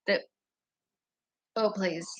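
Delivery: ordinary delivery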